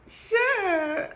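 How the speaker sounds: high, loud, chirpy agreement; bright squeaky instant yes; eager yes. Retold or imitated. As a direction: jump at the offer. Her eager reply to his offer.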